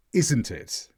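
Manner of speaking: "isn't" stressed, "it" falling away. Shown as a demonstration. Teacher expects agreement on the obvious answer, "isn't" stressed, falling. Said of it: "being more stressed meant I was expecting agreement".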